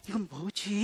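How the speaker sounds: low, quiet aside of mild bafflement; puzzled onlooker. Retold or imitated. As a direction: wonder it softly to yourself. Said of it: "이렇게 하는 놈". (나직이 의아해하는 혼잣말)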